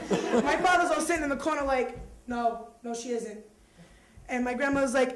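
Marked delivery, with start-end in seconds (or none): 2.3-3.4 acted sulky father, dropping away quieter; muted denial. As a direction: mutter it from the corner in denial. Father sulks in corner, denying.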